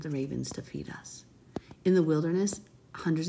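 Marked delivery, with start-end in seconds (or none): 0.0-1.2 hushed whisper of faith. Real, not acted. Preacher whispering hope to her viewers. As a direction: breathe it like a secret. Drops to a hush after repeating.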